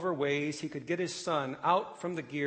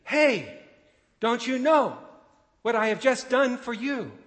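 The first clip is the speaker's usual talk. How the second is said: second full-lung scream, much higher and louder; screaming again; 9 semitones higher, 9 dB louder than usual. A narrator's reading. Father screaming once more at the train, desperate yell. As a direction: cry out again, pleading and unheard. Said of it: "so he screamed again".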